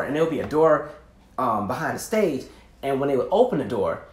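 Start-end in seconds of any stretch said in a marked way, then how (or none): none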